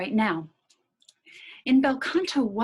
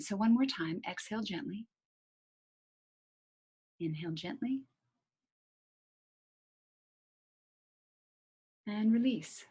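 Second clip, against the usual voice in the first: hushed, gentle guiding voice; soft breathing cue; 7 dB quieter than usual. Shown as a demonstration. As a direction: talk them gently through the breath. Guided breathing cues, very soft.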